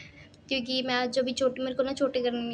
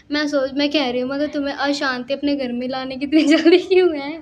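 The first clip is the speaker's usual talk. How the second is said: loud joking; 3 semitones higher, 10 dB louder than usual. Real, not acted.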